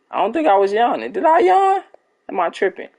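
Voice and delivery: high-pitched puzzled questioning; incredulous. Real, not acted. Streamer answering viewers about yawning, incredulous. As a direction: ask it high, in real disbelief. Denies a listener's claim of yawning.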